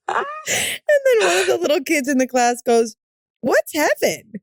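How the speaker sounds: high and fast through laughter; laughing while telling. Real, not acted.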